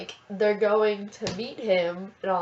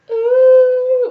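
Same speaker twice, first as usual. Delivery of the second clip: loud, high happy sobbing ooh; joyful wail; 16 dB louder than usual. Retold or imitated. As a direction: wail it through tears of joy. Sobbing on floor from joy.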